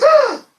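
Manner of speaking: sudden high, loud yelp; a man caught out yelps and flees; startled yelp. Retold or imitated. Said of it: "And he goes".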